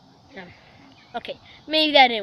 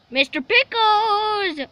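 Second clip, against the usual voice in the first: calling out; 6 semitones higher, much slower than usual. Pretend. Villain calls out for the dog.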